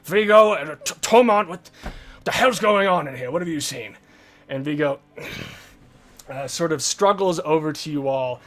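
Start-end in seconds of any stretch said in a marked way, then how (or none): 0.0-4.0 louder, pressing for answers; urgent commanding. Acted. Leader questioning Vigo, urgent and loud. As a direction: demand answers now. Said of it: "he's like".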